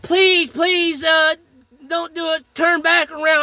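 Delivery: high, urgent begging, retold; imitated desperate pleading. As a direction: mimic a wife begging him to stop. Retold wife begging him to stop.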